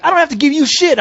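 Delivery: high-pitched imitation of an irate cop; retold angry refusal. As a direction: act out his cursing refusal. Acted quarrel, cop's side.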